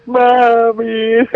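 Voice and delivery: high wailing "Mommy" in a mock sob; mock crying hustler. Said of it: "he started crying and going".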